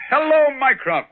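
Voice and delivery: high, drawn-out hello to his brother; booming welcome to the arriving brother; hearty greeting. Acted. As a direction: call out a big welcome.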